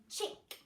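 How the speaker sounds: Chick with a crisp, hard ch; stressed ch. Shown as a demonstration. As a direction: snap out the ch in Chick. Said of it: "ch sound at the beginning".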